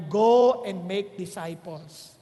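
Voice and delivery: slow, loud stress on Go; emphatic command. Shown as a demonstration. Preacher stressing the Bible's command Go to the congregation. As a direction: hit Go hard and draw out the command. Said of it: "very intentional".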